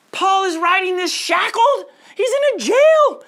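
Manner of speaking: fervent preaching. Real, not acted. Pastor stresses Paul's jail to congregation, fervent. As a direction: proclaim Paul's chains with fire.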